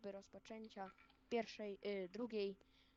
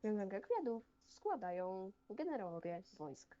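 ceremonial announcement. Acted. Announces wreath laying ceremonially.

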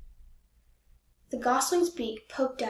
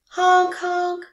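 loud, high honk like a goose; 7 semitones higher, 9 dB louder than usual. A narrator's reading. Goose honk imitated in story.

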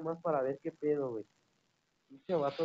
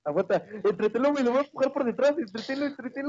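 urgent, fast, high shouting; yelling from the sidelines; 6 semitones higher, 6 dB louder, faster than usual. Real, not acted. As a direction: shout orders mid-fight. Urgent teamwork in a fight.

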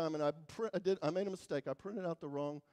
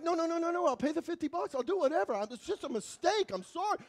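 higher, hurried begging; frantic pleading; 7 semitones higher, faster than usual. Retold or imitated. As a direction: beg your way out of trouble. Pleading with customs officer.

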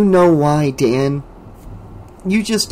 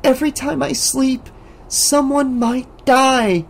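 fearful plea; 11 semitones higher than usual. A narrator's reading. Alice telling Dan why she stays awake. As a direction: warn him gravely.

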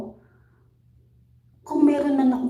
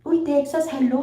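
cheery greeting; 4 semitones higher, 4 dB louder than usual. Real, not acted. Greeting a viewer by place.

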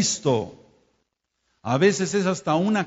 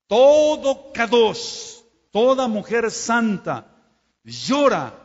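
fervent preaching cry; 7 semitones higher, 6 dB louder, much slower than usual. Real, not acted. Fervent preaching about weeping saints.

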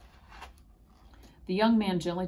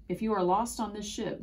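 plain reading voice

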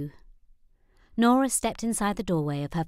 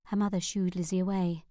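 plain reading voice

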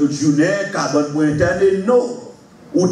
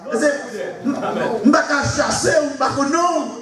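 preaching at full force; 7 semitones higher, faster than usual. Acted. Preacher rallying the congregation, shouting and high. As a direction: rouse the church against demons.

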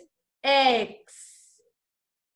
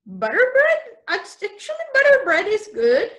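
delighted; 7 semitones higher, 5 dB louder, faster than usual. Real, not acted. Teacher's delighted reaction.

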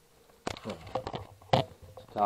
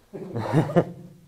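burst of laughter; 4 semitones higher, 7 dB louder than usual. Real, not acted. Laughs at partner.

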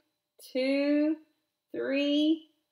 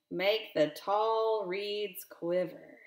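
ordinary delivery, nothing marked

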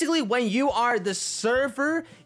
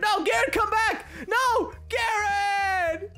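calling after; 13 semitones higher, much slower than usual. Pretend. Begging the leaving customer Garrett to come back, shouting. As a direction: plead after him as he walks away.

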